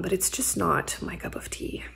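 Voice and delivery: a soft, breathy confession; whispered admission. Real, not acted. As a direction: whisper the verdict, a little guilty. Quiet admission, dislikes the book.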